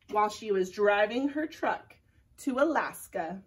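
ordinary delivery

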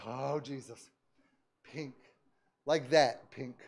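soft, low murmur of wonder; hushed amazement. Retold or imitated. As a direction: marvel at it under your breath. Quiet amazed relief at his skin.

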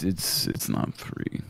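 quiet, slow, almost breathed; sheepish mumble. Acted. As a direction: mumble it guiltily. Admitting a hidden charge.

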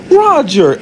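high-pitched exclaimed name; surprised reproach. Acted. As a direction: call his name high, taken aback. Amber reacts to secret brother.

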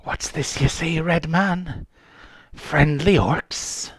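calmed-down castaway, lightly voiced; wary unhinged pirate woman. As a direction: question the strangers as a wary old sea-dog woman. Plays the pirate woman, calming.

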